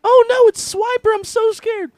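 very high, loud squeaky mock fear; hammed-up cartoon panic; scared cartoon kid. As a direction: squeal it like a frightened cartoon character. Acting out Dora cartoon scene.

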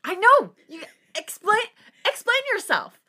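very high, loud hammed-up demand; mock outrage. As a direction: shout it in comic indignation. Reader joins in the mock outrage.

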